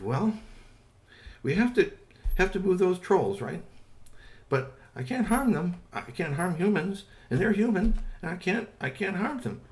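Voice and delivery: small helper explaining earnestly; eager Squeaky Toy. Acted. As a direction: plead the case brightly and high. Squeaky Toy explains his plan.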